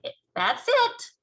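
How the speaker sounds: high, slow proud giant voice; proudly. Acted. Giant shows Sophie the nightmare, proud and high. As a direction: beam with pride over it. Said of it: "the B F G said proudly".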